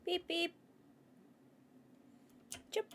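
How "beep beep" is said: high little car-horn imitation; two high pretend honks; playful horn beeps. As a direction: beep your way through, pitched up. Playing a horn while pushing through.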